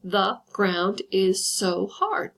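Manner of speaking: very slow, each syllable spaced out; counting out five beats on the line. Shown as a demonstration. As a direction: tap out every syllable slowly. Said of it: "make sure that fits the criteria of five syllables".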